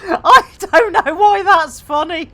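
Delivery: high, loud, laugh-choked words; helpless laughter. Real, not acted. Streamer baffled by their own joke, laughing helplessly. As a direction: talk through real giggles.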